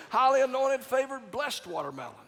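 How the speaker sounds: mock prosperity preacher. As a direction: ham up the anointing words. Repeats the phrase, mocking it louder.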